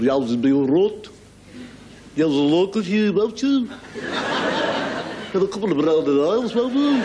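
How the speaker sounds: exaggerated Brummie from the back of the throat; slow, throaty Midlands caricature, rising at the end; Birmingham accent. Comic imitates the Midlands around Birmingham, throaty Brummie accent. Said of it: "speak from the back of their throat".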